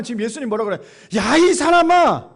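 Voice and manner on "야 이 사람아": slow, loud, higher exasperated cry; drawn out and stressed, Jesus rebuking the greedy man; preacher voicing Jesus scolding the man, loud and slow; emphatic rebuke. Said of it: "이런 강조를 하지 않았을까요".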